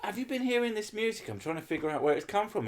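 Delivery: a high, lightly voiced polite neighbour question. Asking the neighbour politely about the music. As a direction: ask sweetly, trying to stay nice. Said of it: "I'm saying".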